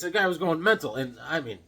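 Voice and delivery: ordinary delivery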